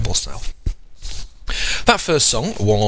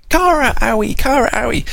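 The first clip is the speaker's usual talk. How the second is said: put-on very high, louder childlike calls; two toddlers greeting; 3 dB louder than usual. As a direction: squeal the names like happy little kids. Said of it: "they'll be like".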